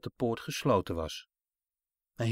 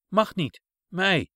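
put-on toddler protest; 6 semitones higher, 5 dB louder, slower than usual. Toddler protests about his necklace.

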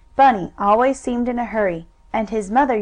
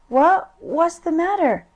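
put-on sleepy child; 7 semitones higher than usual. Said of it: "sleepily mumbled little Bunny Brown".

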